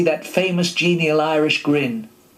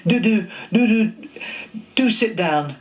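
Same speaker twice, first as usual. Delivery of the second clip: high nervous stutter on do, do, do; 8 semitones higher than usual. Retold or imitated. Flustered actor inviting Gene Kelly to sit, stuttering. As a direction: trip over the first word until it comes out. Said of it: "I stuttered".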